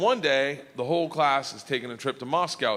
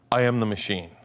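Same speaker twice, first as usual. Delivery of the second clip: low deadpan; 6 semitones lower than usual. Retold or imitated. Deadpan first telling of botched line.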